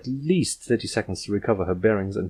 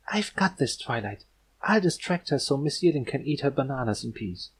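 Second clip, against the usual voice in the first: meek determination; 5 semitones higher than usual. Acted. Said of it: "got a determined look".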